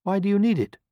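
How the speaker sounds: high-pitched questioning hen; nervous hen. A narrator's reading. Hen questioning the wolf about her pot, nervous. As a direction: ask it uneasily, pitched right up. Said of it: "feeling distinctly nervous".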